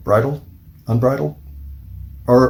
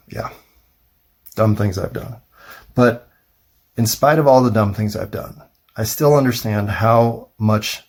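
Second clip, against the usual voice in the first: normal talking voice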